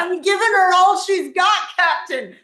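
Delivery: bad Scottish accent, high and loud; hammy Scottish engineer shouting. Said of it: "My worst Scottish accent".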